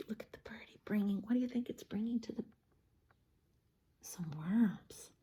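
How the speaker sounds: fast hushed whisper; quiet aside. Real, not acted. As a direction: point it out secretly.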